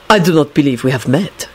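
formal, courteous introduction; lightly voiced courtly politeness; very polite. A narrator's reading. Dragomir greets Neville's mother, very politely. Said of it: "he said very politely".

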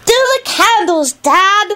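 put-on very high, excited child voice; drawn-out, high-pitched eagerness; Teddy, enthusiastic. A narrator's reading. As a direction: plead eagerly like a thrilled little boy. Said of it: "Teddy enthused".

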